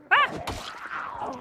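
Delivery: sudden high unvoiced yelp of fright; breathy scream. Real, not acted. Player jolted by a jump scare, breathy yelp.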